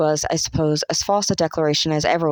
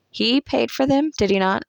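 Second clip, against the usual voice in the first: put-on pert young girl; 6 semitones higher than usual. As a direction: needle her with the question. Estella's pointed question.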